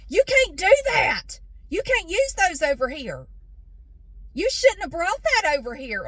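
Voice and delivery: high, loud scolding; mock a worker yelling at a customer. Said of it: "Why is he yelling at me".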